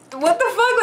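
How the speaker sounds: high, startled cry of alarm; a sudden high yelp as a stranger grabs you; panicked. Retold or imitated. Woman pulled backwards by a drunk stranger, high alarmed cry. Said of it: "I just start panicking".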